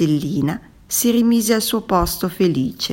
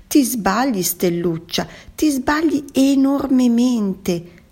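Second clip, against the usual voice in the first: emphatic correction; 7 semitones higher, 3 dB louder than usual. A narrator's reading. Squid telling the starfish she is wrong, emphatic. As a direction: lean hard and high on the mistake.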